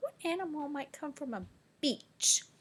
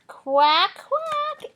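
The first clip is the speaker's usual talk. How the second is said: loud higher quacks; duck quacking; 13 semitones higher, 9 dB louder than usual. Pretend. Teacher quacks like a duck for the class.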